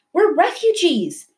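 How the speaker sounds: high, pleading cry; yelling. A narrator's reading. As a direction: call out to the guards in protest. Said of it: "Mahmoud yelled".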